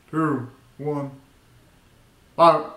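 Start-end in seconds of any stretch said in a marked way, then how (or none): none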